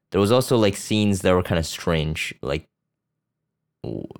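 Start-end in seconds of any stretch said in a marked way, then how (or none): none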